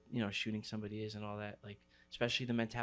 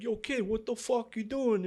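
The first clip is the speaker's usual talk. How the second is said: high, loud Brooklyn holler; thick Brooklyn accent; 11 semitones higher, 5 dB louder than usual. Retold or imitated. Train driver to a kid on the tracks, thick Brooklyn accent. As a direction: Brooklyn motorman calling out in disbelief. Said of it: "thick Brooklyn accent".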